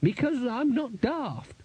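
high, slow character voice; the Chair's retort. A narrator's reading. Wizard chair explains going upstairs, high drawn-out retort. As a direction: draw the retort out in a high pitch.

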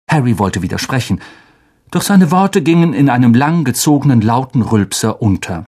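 usual speaking voice

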